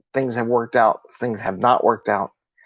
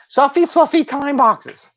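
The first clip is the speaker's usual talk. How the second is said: very high, slow silly voice; mock fluffy voice; 4 dB louder, slower than usual. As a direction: draw it out in a silly falsetto. Mocking soft time boxes.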